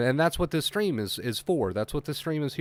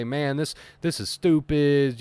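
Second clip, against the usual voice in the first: mock frustrated rant; 3 semitones higher, slower than usual. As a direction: play the annoyed self-talk, dragging out stupid. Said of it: "You can get mad".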